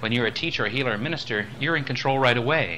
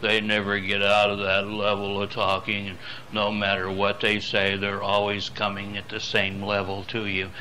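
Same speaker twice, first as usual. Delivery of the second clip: monotone; 3 semitones lower than usual. Shown as a demonstration. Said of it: "others are us talking like this".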